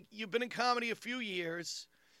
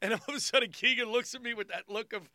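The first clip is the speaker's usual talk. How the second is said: ordinary delivery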